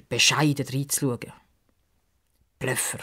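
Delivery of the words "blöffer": softer, an inner scornful thought; thought to herself. A narrator's reading. Pippa thinks it quietly about the boaster. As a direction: mutter it quietly in her head. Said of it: "denkt Pippa".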